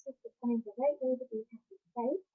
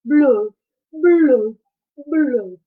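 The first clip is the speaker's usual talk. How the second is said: glum fish bubbles; 9 dB louder, much slower than usual. Acted.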